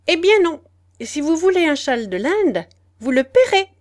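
high, loud flat refusal. Acted. Old lady refuses the father, loud and high. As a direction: slam the door on the request, loud and high.

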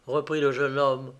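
ordinary delivery

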